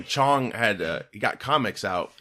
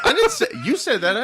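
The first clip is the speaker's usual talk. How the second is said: high, loud, laughing denial; laughing protest; 6 dB louder than usual. Real, not acted. Denying through laughter.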